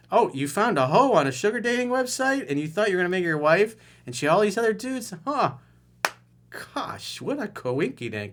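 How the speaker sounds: high, loud fake astonishment; sarcastic mock surprise. Coach mocks the caller, sarcastic and high. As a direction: sneer it out like it's a shocking twist. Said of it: "I'd be like".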